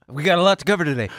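rushed, breathless and fast; all in one breath. Real, not acted. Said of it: "You're like a cartoon character".

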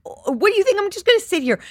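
high-pitched, incredulous protest; exasperated retort. Retold or imitated. Wife to husband about the napkin, high and exasperated.

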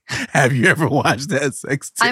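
high, loud, drawn-out mimicry; exaggerated imitation of a too-casual ask; mock casual question. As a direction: mockingly parrot the co-host's question. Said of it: "you could casually be".

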